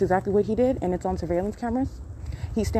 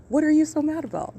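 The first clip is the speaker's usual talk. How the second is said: pitched high and louder, incredulous; exasperated repeat; 9 semitones higher, 4 dB louder than usual. Retold or imitated. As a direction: fling the question back, disbelieving. Repeated retold reply, much higher.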